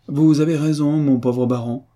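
high, innocent contrition; naive and contrite. A narrator's reading. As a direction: agree with mock-innocent regret. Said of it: "d'un air naïf et contrit".

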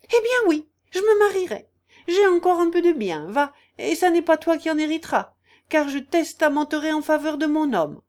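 very high, loud and defiant; spiteful retort. Acted. Aunt threatening to marry and disinherit her niece, defiant. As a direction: fling it back in a shrieked threat.

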